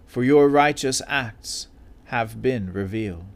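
measured formal Bible reading; scripture reading. Real, not acted. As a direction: read it out solemnly to the congregation. Lector reads scripture aloud.

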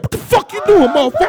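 high, sharp indignant yell; angry outburst. Retold or imitated. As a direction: snap at him over the bottle. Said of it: "I said".

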